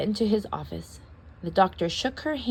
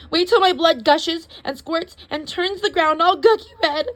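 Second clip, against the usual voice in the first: melodramatic; 8 semitones higher, 5 dB louder than usual. Acted. Gleefully dramatic.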